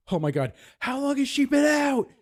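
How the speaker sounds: high, loud mock panic; alarmed shout. Nurse finding a donor passed out, alarmed shout.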